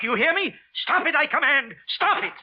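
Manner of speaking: high, loud frantic command; frantic shout. Acted. Wilson shrieking at the voices to stop.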